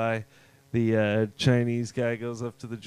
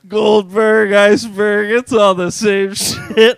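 much louder, higher, laughing; loud laughing punchline; 6 semitones higher, 12 dB louder than usual. Retold or imitated. Joke-teller delivering the final punchline, loud and laughing. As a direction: belt the punchline through laughter. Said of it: "he's like".

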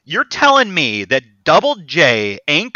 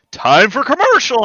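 higher-pitched imitation of a break announcement; mock commercial announcement; 6 semitones higher than usual. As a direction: cut in cheerily to announce a break. Interrupting announcement voiced in retelling.

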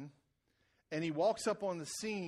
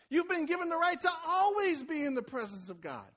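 loud, high, rising sermon climb; full-force preaching; 9 semitones higher, 7 dB louder than usual. Acted. Preacher urging the church toward God's presence, fervent loud preaching. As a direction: preach it up to a shout.

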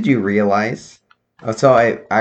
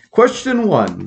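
higher, slow announcing voice; game show host; 10 semitones higher, 3 dB louder, slower than usual. Acted. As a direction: announce it to the studio. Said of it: "are you purposely doing a, like, game show host?".

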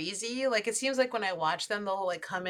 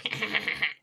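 imitated squirrel chitter, breathy and rapid; squirrel noise. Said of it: "And he was like".

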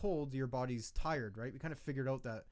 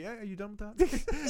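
sweet asking voice; 7 semitones higher, 5 dB louder than usual. Pretend. Voicing himself asking a baby.

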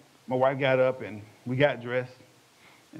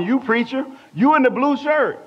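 loud, high preacher's summons; single someone out from the pulpit; pastor calling out; 12 semitones higher, 7 dB louder than usual. Retold or imitated. Pastor picking one man from the congregation, loud urgent call.

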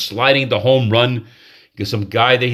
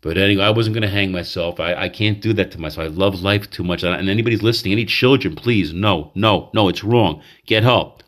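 usual speaking voice

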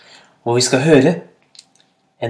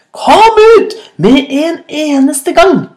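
slow, high, full-volume bellow; furious roar; 13 semitones higher, 6 dB louder, slower than usual. A narrator's reading. Hyena ordering rabbit out of cave, roaring furiously. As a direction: roar the order out, stretching the words. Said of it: "brølte hyenen".

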